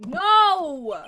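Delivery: long, loud, high no; loud cry of protest. Real, not acted. Streamer hearing the verdict is coming, loud protesting no. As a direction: howl it in protest.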